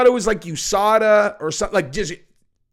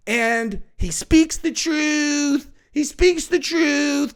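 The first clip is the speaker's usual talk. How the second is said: higher, slow, drawn-out mock wisdom; mock solemn quote; 7 semitones higher, slower than usual. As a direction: stretch it out as if profound. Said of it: "she's like".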